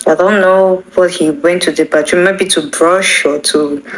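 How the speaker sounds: ordinary talk, nothing marked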